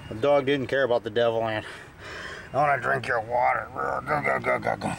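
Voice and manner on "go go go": ordinary delivery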